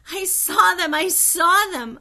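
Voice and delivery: high, loud, joyous repetition; joyful. A narrator's reading. Augusta repeating in joy, high and loud. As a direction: laugh it out in delight. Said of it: "She burst out laughing".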